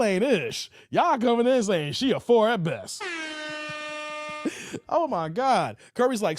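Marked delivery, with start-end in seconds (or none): 3.1-4.8 high, breathy burst of laughter; laughing hard. Real, not acted. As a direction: crack up at the comment. Host cracks up at chat comment.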